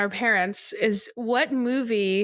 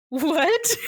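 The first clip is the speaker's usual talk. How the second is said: laughing, higher-pitched incredulous retort; baffled reaction; 5 semitones higher than usual. Retold or imitated. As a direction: imitate his baffled "what?", half laughing. Brother's baffled reaction, retold with laughter.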